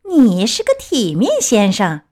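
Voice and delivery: plain reading voice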